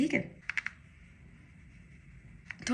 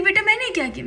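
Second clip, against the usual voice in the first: much higher, startled auntie asking; auntie's surprise; 11 semitones higher, 5 dB louder than usual. Retold or imitated. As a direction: mimic the aunt taken aback. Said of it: "कहती".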